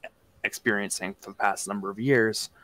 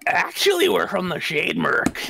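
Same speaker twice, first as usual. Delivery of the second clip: higher, half-hearted goblin voice; 7 semitones higher, 5 dB louder than usual. Acted. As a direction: attempt a goblin voice, and fall short. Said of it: "I can't do a goblin voice".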